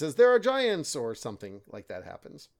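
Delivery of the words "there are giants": high, louder retold cry of news; mock alarmed announcement. Retelling an outsider's news at Arthur's feast, mock alarmed announcement. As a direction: imitate someone bursting in with big news. Voicing someone bursting in with news.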